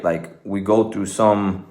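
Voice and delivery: ordinary talk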